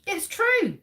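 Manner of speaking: high, loud "true" called out; dramatic reveal. Real, not acted. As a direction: burst out the answer like a game-show host. Quiz host reveals the answer.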